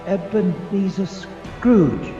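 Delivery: slow, halting reading of the name; slow dawning horror; dread. Acted. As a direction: read it out haltingly, dreading each part. Reads own name on gravestone.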